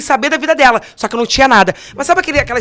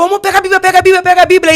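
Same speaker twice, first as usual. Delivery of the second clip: much higher and louder, hurried urging; urgent excitement; 8 semitones higher, 7 dB louder, faster than usual. Retold or imitated. Wife urges her jailed husband to get the Bible, excitedly. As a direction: press him to grab the Bible, all fired up.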